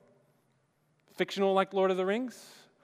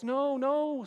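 higher, lightly voiced pleading Smeagol; a hint of a whimpering creature; a mild high-pitched protest; 9 semitones higher than usual. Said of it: "Smeagol says".

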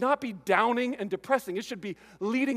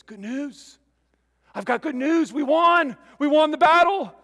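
rising to a joyful, much louder cry of victory; excited messenger; 8 semitones higher, 10 dB louder than usual. Acted. Messenger shouting victory to the waiting village. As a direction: burst out with the good news of the win.

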